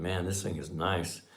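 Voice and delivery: soft, low admiring aside; inner thought. Retold or imitated. Buyer secretly admiring the car, soft inner thought. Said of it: "in my head, I was thinking".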